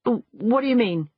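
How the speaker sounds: stammered, uneasy question; nervous. A narrator's reading. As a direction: stumble anxiously into the question. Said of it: "said Meg nervously".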